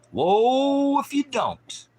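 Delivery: "Woe" cried out very high, loud and drawn out; high, slow prophetic cry; thundering woe. Acted. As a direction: wail the warning slowly. Thundering warning.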